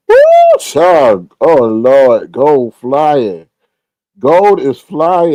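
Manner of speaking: loud whoop, then loud amazed exclaiming; excited astonishment. Real, not acted. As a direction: holler in amazement at the news. Sees gold price soaring.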